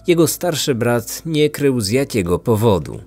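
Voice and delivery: plain reading voice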